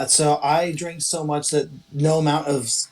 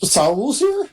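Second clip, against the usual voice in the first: sudden higher startled question; 4 semitones higher, slower than usual. Acted. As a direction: jolt awake in alarm. Character startled awake by news.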